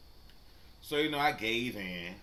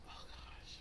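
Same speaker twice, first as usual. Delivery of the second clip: hushed exasperated whisper; manager's exasperated whisper; whispered aside; 16 dB quieter than usual. Retold or imitated. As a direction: breathe it out, barely voiced. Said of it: "He says".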